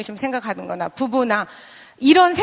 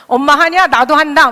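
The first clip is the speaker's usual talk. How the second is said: loud, higher mock yell; shouting back; 3 semitones higher, 8 dB louder than usual. Child taking on mom, defiant imitated shout. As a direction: imitate a kid squaring up, loudly. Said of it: "막 이러면서 소리 지르거나".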